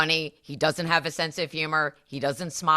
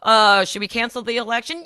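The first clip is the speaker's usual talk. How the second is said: higher, louder sly question; mocking Trump; 6 semitones higher, 4 dB louder than usual. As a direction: float it like a leader testing the crowd. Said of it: "And Maga's like".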